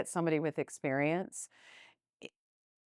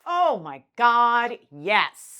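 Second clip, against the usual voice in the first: excited; 8 semitones higher, 10 dB louder, much slower than usual. Retold or imitated. Retelling her own joyful reaction.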